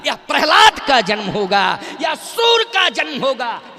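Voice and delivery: loud, ringing preacher's proclamation; rousing proclamation. Real, not acted. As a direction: proclaim each great name with full force. Preacher's climax in a list of births. (जोश भरी ऊँची घोषणा)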